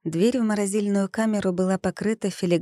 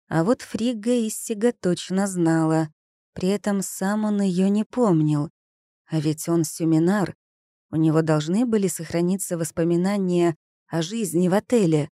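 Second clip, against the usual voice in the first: plain reading voice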